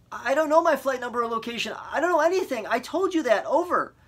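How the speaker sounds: high, loud, fast exasperated voice; frustrated panic. A narrator's reading. Boy shouting at the operator he already told. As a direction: snap it out, scared and fed up.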